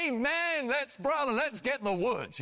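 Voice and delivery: high-pitched, hammed-up zeal; mock exuberance. Imagined Abraham jumping up and down, mock-excited about getting wood. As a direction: imitate an over-eager believer. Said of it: "jumping up and down and saying".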